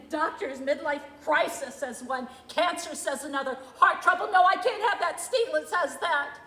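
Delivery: a loud, scornful flare of anger; bitter outburst. Acted. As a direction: lash out at the diagnosis. Bitter outburst over diagnoses.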